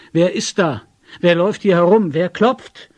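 loud, high repeated questions; urgent, demanding shout; shouted challenge. A narrator's reading. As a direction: call out to an unseen prowler. Man circles house calling into night.

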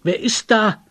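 loud, high challenge; shouting. A narrator's reading. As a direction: shout out at an unseen visitor. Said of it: "schrie Tegeljow".

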